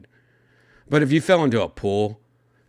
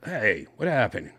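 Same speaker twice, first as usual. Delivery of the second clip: ordinary talk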